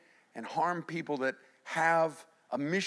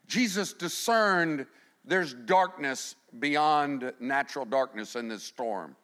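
ordinary delivery

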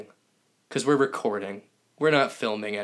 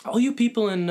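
calling out; 11 semitones higher than usual. Real, not acted. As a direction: shout out to faraway fans. Calling out to listeners.

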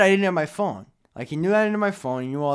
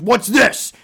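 high, loud mock demand; accusing cop; 7 semitones higher, 7 dB louder than usual. Cop feeling keys during frisk, accusing. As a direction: imitate a cop pouncing on evidence.

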